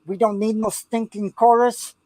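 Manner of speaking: a hammed-up movie bandit Spanish accent, pitched a bit high; mock Spanish accent. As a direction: play a Mexican bandit from an old Western, tongue in cheek. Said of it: "that was my best Espanol".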